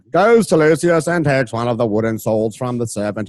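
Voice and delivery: normal talking voice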